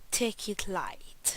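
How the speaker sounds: slow, drawn-out English accent on the title; English pronunciation, stretched out. Shown as a demonstration. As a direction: say the game's title like an Englishman, slowly. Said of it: "Mon magnifique accent anglais".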